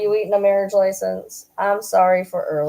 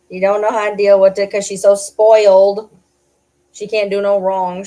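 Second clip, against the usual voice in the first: loud teasing; 2 semitones higher, 8 dB louder than usual. Real, not acted. Teasing the spoiled dog.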